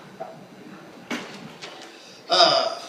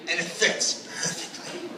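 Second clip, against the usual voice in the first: whispered aside; 2 semitones lower, 8 dB quieter, slower than usual. Real, not acted. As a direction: drop to a slow whisper. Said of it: "whispered aside".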